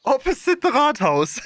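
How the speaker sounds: high, mock German-accented English; a German granny reading the sign; grandma's German accent. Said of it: "This is how my, how my grandmother would speak English".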